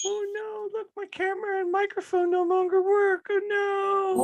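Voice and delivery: elf voice. Acted. Elf voice filter.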